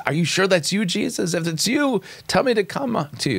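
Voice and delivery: a nervous Peter testing Jesus; doubting Peter. Retold or imitated. Paraphrasing Peter to his audience, high doubtful pleading. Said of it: "he's acting in doubt".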